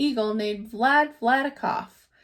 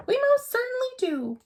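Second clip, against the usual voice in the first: much higher, piping little voice; piped tiny voice; 13 semitones higher than usual. A narrator's reading. Mayor answers Horton from the speck, high piping yes. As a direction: pipe it up brightly like a tiny creature. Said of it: "piped the voice".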